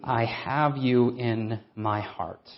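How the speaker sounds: leaning on the word you, slow; slow quoting. Shown as a demonstration. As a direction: let "you" carry the weight. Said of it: "look at that phrase".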